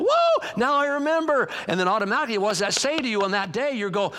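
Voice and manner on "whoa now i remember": high, drawn-out whoa of recognition; sudden realization. Acted. Reader spots old sermon notes, sudden realization. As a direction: act out remembering, pitched up and slow.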